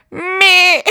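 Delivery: mock deer bleat; imitating mom's deer call. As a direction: bleat like a mom calling a fawn. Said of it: "start trying to call it in and go".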